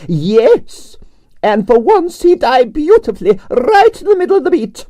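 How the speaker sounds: grand Russian ballerina complaining about her night; Russian prima ballerina. Acted. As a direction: play the dramatic Russian diva. Arienskaya held voice.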